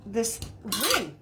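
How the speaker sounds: ordinary talk, nothing marked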